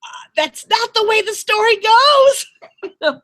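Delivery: embarrassed, half-laughing correction; flustered laughing protest. Retold or imitated. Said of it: "And I'm like".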